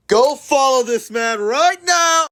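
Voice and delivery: high, loud excited yell; loud shout. Real, not acted. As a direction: belt it out like a hype call. Repeats plea, now shouting.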